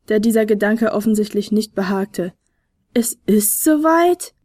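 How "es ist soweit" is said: slow, higher, amazed question; astonished. A narrator's reading. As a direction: echo it in wonder, pitch rising. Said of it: "staunte der Flötenspieler". (staunend, langsam nachgefragt)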